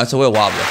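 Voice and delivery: "wobbler" meant with an R but sounding like W. Real, not acted. Friend insisting he says R, W sound in "wobbler". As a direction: round the intended R of "wobbler" into a W. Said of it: "in my head, I'm saying an R".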